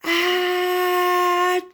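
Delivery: big wet achoo sneeze; loud sneeze. A narrator's reading. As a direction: sneeze it out hard. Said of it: "Dennis sneezed again".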